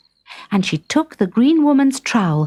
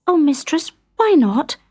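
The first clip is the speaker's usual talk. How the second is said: put-on higher, eager young girl asking; lightly voiced girl begging to know; young maid asks her mistress why; pleading girl; 8 semitones higher than usual. A narrator's reading. Kate pleads.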